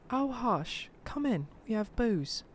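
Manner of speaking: high, soft woman's voice; soft, high-pitched put-on female voice; hushing woman character. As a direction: usher him in softly, pitched high. Old lady's guests usher him in.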